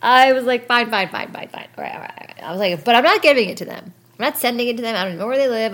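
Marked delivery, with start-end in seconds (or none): 0.7-2.4 exasperated caving-in, dying away; mock grudging surrender. Acts out giving in to therapist. 2.8-5.7 higher, louder, quick protest; insistent, worked-up objection; mock defiant insistence. Acts out defiant condition to therapist.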